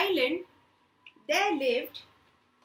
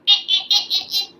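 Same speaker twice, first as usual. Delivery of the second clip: loud higher barking brays; zebra bray; 8 semitones higher, 14 dB louder, much faster than usual. Retold or imitated. Storyteller imitating zebra for children, loud braying. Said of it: "The zebra will go".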